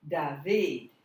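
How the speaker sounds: second syllable of David hit loud; stress on second syllable. Shown as a demonstration. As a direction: punch the last half of David. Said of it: "It's on the second syllable".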